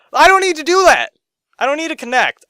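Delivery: high, loud exasperated shout; frustrated shout. Real, not acted. Frustrated rant peak.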